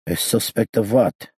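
put-on accented question, backed off from shouting. A narrator's reading. Gangster learning he is a suspect, subdued accented question. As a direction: ask warily in a high foreign accent, no longer shouting. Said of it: "lowering his voice".

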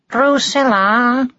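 high, drawn-out singsong call. A narrator's reading. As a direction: coax them out, stretching the name. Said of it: "called Martin".

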